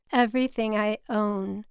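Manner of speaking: ordinary delivery, nothing marked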